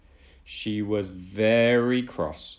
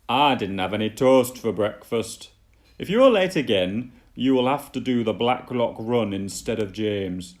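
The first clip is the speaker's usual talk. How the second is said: louder stern scolding; cross boss; 6 dB louder than usual. Acted. Fat Controller scolding Emily about toast, loud and cross. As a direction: scold the late engine firmly.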